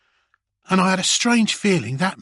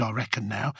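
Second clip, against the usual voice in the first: plain reading voice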